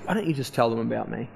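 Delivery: low, soft, understated voice; quiet low divine prompting; Holy Spirit. Retold or imitated. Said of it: "the Holy Spirit just says to me".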